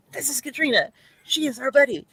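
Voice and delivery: high-pitched show-and-tell voice; acted introduction. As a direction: introduce a buddy in a put-on high voice. Voicing a caption introducing their buddy.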